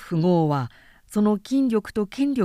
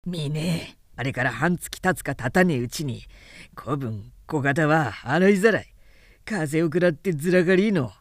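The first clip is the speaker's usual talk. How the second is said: rough, mocking taunt, lower at times; sneering townsman; 3 semitones lower than usual. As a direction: townsmen mocking the injured constable outside his house. Taunting outside the injured man's house.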